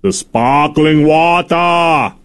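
slow, drawn-out giant impression; Andre the Giant. Co-host mocking the French accent in the clip, slow giant impression. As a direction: stretch each word out like a lumbering giant. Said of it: "get Andre the Giant in there".

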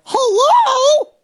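very high, loud laughing "hello"; shocked falsetto. Retold or imitated. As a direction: squeal it in mock shock. Mock shocked reaction.

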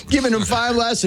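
normal talking voice

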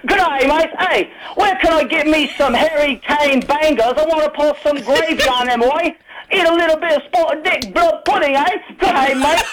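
high-pitched, hammed-up London accent; mock British pub shout. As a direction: holler like a fake Londoner walking into a bar. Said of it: "walking into a bar screaming".

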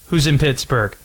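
usual speaking voice

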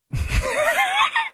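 shrieking burst of laughter; bursting out laughing. Real, not acted. Host laughs loudly mid-rant.

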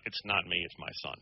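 low mock-confidential murmur, fading to a whisper; confiding aside. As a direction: pretend to lean over and quietly pass the blame. Acts out leaning over confidentially.

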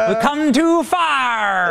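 slow, drawn-out mock Southern accent; mock Southern preacher accent. Host mocking Hillary Clinton's speech to cohost, slow mock Southern accent. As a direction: ham up a fake Southern church cadence, very slow. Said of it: "Do you remember Hillary Clinton?".

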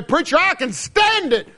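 high, loud whiny mock voice; hammed-up voice of a shrugging listener. Mocking the indifferent listener.

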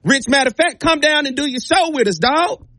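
enthusiastic invitation. Acted. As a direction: pitch the visit as the coach would, voice lifted. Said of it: "By just saying".

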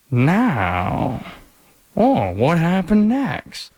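slow, hushed dramatic Dateline teaser; Keith Morrison impression. Host imitates the Dateline narrator for co-host, slow. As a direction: draw it out like a true-crime narrator. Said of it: "When Bill Hader's playing Keith Morrison".

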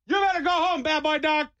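high mock scolding of a stray dog. Biker scolding a stray dog, high mock scolding. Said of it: "I'm like".

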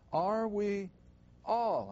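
"all" set apart by pauses and drawn out; stress on "all". Real, not acted. Teacher reading scripture to a class, stressing "all". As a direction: lean on "all", holding it after a pause. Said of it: "I always emphasize that word".

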